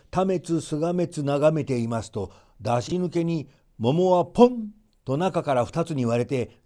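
plain reading voice

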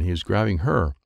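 plain reading voice

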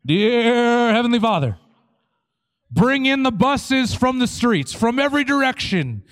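hammed-up prayer, stretched words at full volume; fervent Pentecostal prayer. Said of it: "We pray these prayers, we say".

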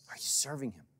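hushed whispered repeat of the question. Acted. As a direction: drop to a whisper to press it home.

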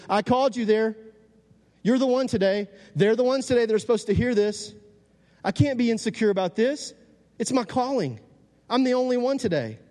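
high-pitched, insistent sermon delivery; fired-up preacher; impassioned preaching. Acted. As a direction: declare it high and emphatic, like a calling. Preaches, voicing God and himself.

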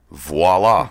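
voila with a French flourish, vwah-LAH; a touch of mock French on voila; French accent. Shown as a demonstration. Guest shows off his pet joke in mock French. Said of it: "as the French would say".

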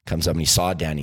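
ordinary delivery

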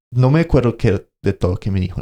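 ordinary talk, nothing marked

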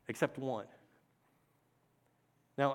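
ordinary talk

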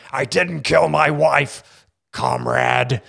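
slow, bad Russian accent; American movie hero faking Russian, drawn out; Harrison Ford. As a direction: plead innocence in a half-baked Russian accent. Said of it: "the guy from The Fugitive".

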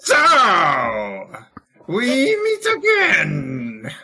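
slow, drawn-out villain greeting; villain reveal. Acted. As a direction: savor each word like a movie villain. Villain's dramatic return.

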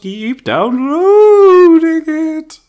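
slow, loud, high spooky drawl. Acted. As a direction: stretch it out like a ghost. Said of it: "It's a Halloween episode".

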